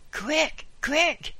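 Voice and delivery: very high, loud panicked squeak; a priest squeaking for help at the door. A narrator's reading. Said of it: "he squeaked".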